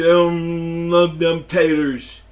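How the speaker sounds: mocking the manager with a slow Sling Blade voice; Sling Blade guy. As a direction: drag it out like the Sling Blade character. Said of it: "He looked like the Sling Blade guy".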